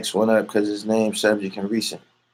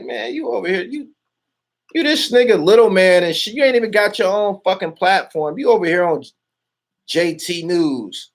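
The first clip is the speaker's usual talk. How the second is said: retold screaming rant; 9 semitones higher than usual. Streamer retelling how he went at his rival, mock screaming. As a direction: re-enact chewing him out, voice pitched way up. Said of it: "I was screaming on him".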